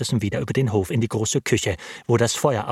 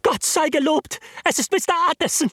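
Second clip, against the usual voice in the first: high, quick cry of relief from the cook. A narrator's reading. Cook greets the lawyer with a high, relieved cry. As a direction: blurt it out, thank God he is here. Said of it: "die Köchin schrie".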